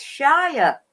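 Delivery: Shire as SHY-uh, falling; full standalone pronunciation. Shown as a demonstration. Guest explaining the horse name, Shire as SHY-uh. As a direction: say Shire in full, rhyming with fire, no r. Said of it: "like in the Shire horse".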